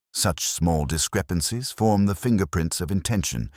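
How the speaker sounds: ordinary delivery, nothing marked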